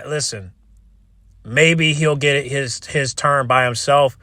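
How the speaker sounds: ordinary delivery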